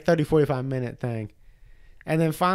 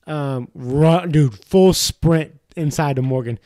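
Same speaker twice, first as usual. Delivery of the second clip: ordinary talk, nothing marked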